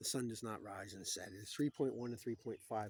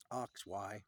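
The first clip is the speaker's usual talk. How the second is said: normal talking voice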